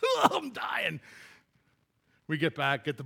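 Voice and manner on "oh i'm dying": higher exaggerated gasp of exhaustion; mock dying shout. Back at the truck after the ride, mock dying cry. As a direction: ham up being worn out. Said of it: "You're like".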